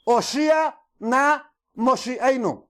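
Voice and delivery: very slow, drawn-out Hebrew acclamation; Hebrew recited. Shown as a demonstration. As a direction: stretch out "Oshia na Moshiach" syllable by syllable. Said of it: "lo que decían realmente en hebreo".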